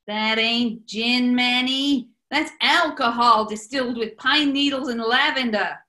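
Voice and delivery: normal talking voice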